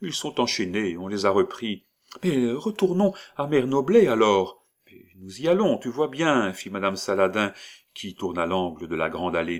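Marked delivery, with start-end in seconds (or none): 0.0-6.5 put-on higher, louder coaxing old woman. Disguised old woman hurries the child along, higher voice. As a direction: play the disguised crone, bright and pushy.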